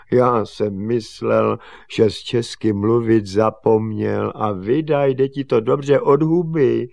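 lightly voiced teasing father; amused father. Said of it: "usmál se tatík".

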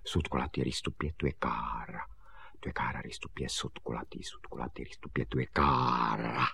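soft, low murmured rote; hushed, half-whispered memorising; reciting to himself. Acted. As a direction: rehearse it under your breath. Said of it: "předříkával si Honzík".

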